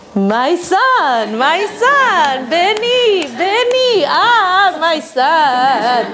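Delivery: mother thrilled at the door; overjoyed mother. Retold or imitated. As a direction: sing out his name in delight. Mother greets son at door.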